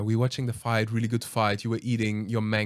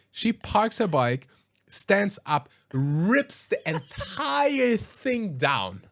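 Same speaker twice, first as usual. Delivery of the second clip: dramatic build-up; 10 semitones higher, much slower than usual. Retold or imitated. Dramatic retelling of rampage.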